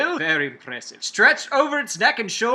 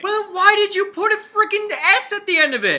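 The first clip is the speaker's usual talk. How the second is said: high, incredulous mock outrage; exasperated outburst; 12 semitones higher, 4 dB louder than usual. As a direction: throw up your hands at the silly spelling. Complaining about the silent S.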